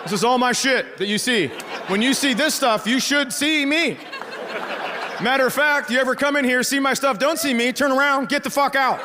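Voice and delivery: hammed-up dad telling off a boyfriend; mock stern rant. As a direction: lay down the house rules, pitch climbing.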